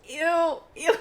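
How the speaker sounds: squealed ew of disgust. Real, not acted. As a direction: recoil at the germs. Teacher's spit on her salsa.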